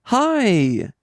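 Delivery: high cheery "Hi"; a light mock nurse greeting; chirpy receptionist. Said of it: "they're like".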